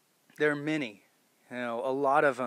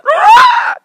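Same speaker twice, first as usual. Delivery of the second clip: piercing, much higher and louder shriek; goblin scream; 23 dB louder than usual. Retold or imitated. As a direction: let out a goblin death shriek.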